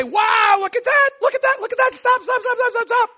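high, loud, rapid calls; amazed passerby shouting; excited shout. Retold or imitated. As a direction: yell at the marvel, urging stop. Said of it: "you'd say".

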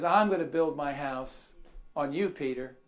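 low, soft, settled statement; quiet correction. Acted. Preacher as Jesus gently telling Peter, quiet and low. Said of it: "What did Jesus just tell him?".

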